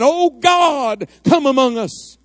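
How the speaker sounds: high, urgent prayerful cry; crying out to God. Real, not acted. Preacher begs God to come among the people, crying out.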